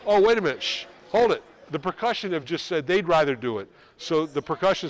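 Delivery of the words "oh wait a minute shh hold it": louder and higher, cutting the band off; sudden loud stop call. Real, not acted. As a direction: halt the band mid-joke. Director stops the band.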